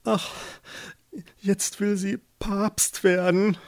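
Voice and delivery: high, slow, timid lament; trembling voice. A narrator's reading. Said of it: "Hein zitterte die Stimme". (mit zitternder, hoher Stimme, zögernd)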